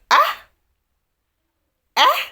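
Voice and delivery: high startled Ah, then Eh; hand-on-head shock; shocked gasp. Retold or imitated. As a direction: jolt up in disbelief. Ken shocked at news.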